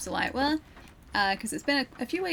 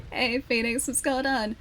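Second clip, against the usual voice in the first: ordinary talk, nothing marked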